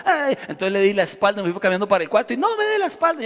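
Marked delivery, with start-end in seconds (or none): 2.4-3.2 high, shrill-voiced mock of the angry mother; imitating angry mother. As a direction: play the mother scolding in a high voice. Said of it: "Ella se enojó tanto". (madre enojada imitada con voz aguda)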